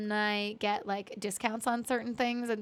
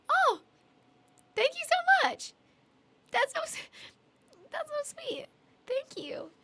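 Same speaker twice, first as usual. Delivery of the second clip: very high, moved thanks; touched squeal; 14 semitones higher, slower than usual. Real, not acted. Streamer thanks a new fan for praising her voice, touched and high-pitched. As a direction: go up high, overwhelmed by the compliment.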